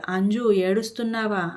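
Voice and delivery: usual speaking voice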